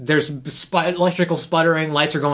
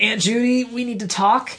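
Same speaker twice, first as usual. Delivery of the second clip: higher-pitched imitation of an urgent niece; imitated niece; 6 semitones higher than usual. Niece needs a talk with her aunt, imitated higher voice. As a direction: lift it up as the niece. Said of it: "and then she's like".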